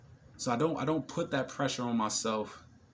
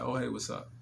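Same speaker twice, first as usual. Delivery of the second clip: softer, cool and easy "hey"; nonchalant greeting; 4 dB quieter than usual. Retold or imitated. Him greeting a beautiful woman, nonchalant. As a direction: toss it off like you don't care. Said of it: "Very nonchalant, very chill".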